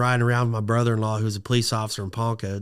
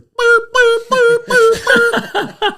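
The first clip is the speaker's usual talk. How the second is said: very high, loud imitated alarm boops; repeated piercing falsetto boops; computer alert beeps; 9 dB louder, much slower than usual. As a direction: mimic an electronic warning going off. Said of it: "and it goes".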